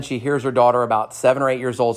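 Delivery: normal talking voice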